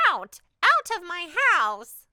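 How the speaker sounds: put-on loud, high shrieking old witch. Old witch shrieks at the cat to leave her house. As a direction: scream it as you shove the cat out. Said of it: "she cried".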